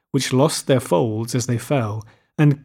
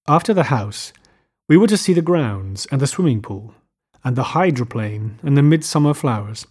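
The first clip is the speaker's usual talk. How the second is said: ordinary delivery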